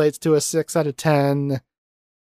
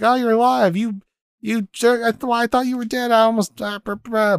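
high-pitched, stammering reunion outburst; mock flustered surprise; 11 semitones higher than usual. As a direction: act out shock tumbling into babble. Acting out hero's shocked reunion.

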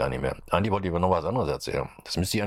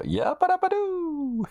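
very high, slow cartoon yell; Fred Flintstone catchphrase; slower than usual. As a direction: stretch it out like a gleeful cartoon caveman. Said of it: "Fred Feuerstein war das".

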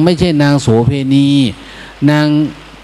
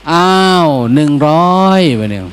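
ordinary talk

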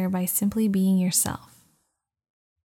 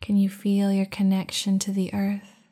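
guided meditation. Acted. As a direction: guide them into calm, unhurried. Leads a meditation.

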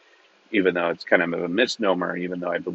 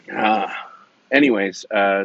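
normal talking voice

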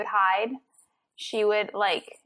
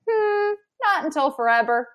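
mock refusal; 6 semitones higher, 6 dB louder than usual. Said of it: "we're like".

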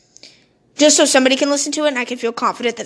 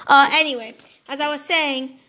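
usual speaking voice

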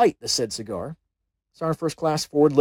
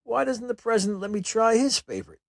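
smooth, higher-pitched diplomatic question; smooth reply; 8 semitones higher than usual. A narrator's reading. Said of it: "I answered as smoothly as possible".

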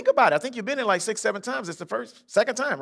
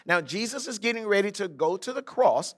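normal talking voice

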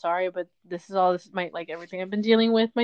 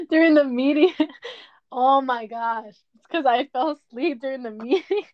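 cracking up, high-pitched, through the words; helpless laughter breaking into speech; laughing while talking; 6 semitones higher, 5 dB louder than usual. Real, not acted. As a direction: fight real giggles while telling it. Cracking up telling embarrassing story.

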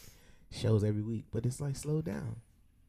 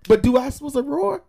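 loud, high protest; high loud question; excited confusion; 10 semitones higher, 13 dB louder than usual. Real, not acted. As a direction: demand it loudly, rising. Confused arguing about joke.

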